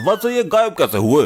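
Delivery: ordinary delivery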